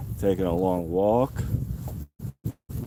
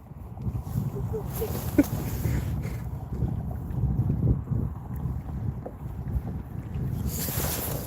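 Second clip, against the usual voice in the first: long silent laugh; 8 dB quieter than usual. Real, not acted. Laughing at own accents.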